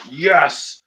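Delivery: loud and high thrilled cheer; excited yes. Pretend. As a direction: cheer it out like a fan. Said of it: "I'd be like".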